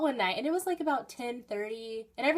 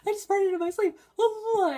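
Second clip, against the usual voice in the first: excited text retold; 11 semitones higher, 8 dB louder than usual. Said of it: "I was like".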